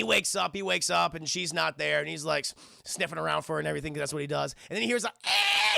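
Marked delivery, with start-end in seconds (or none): 5.1-5.8 high, loud imitated scream; mock scream. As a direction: shriek like a distant cry for help. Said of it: "He hears a scream".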